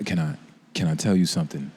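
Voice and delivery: low, smooth R&B crooner murmur. Retold or imitated. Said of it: "You know, like [name]".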